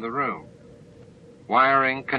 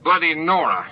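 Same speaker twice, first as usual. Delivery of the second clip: sharp, higher, louder curse; snapped curse; 8 semitones higher, 5 dB louder than usual. A narrator's reading. O'Brien burning his hand, snapping a curse. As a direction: snap the curse out in pain. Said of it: "he snapped".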